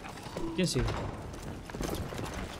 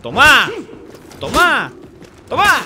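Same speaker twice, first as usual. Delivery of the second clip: building shouts; 5 semitones higher, 9 dB louder, slower than usual. Real, not acted. Landing blows in game.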